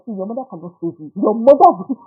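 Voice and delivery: mock threat. Teasing a crying child.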